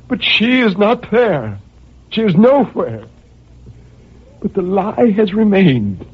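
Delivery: anguished despair. Acted. Madman's despairing climax.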